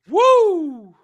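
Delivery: loud, high whooping yelp; mock scream. Mimicking the branded priest running off, high mock scream.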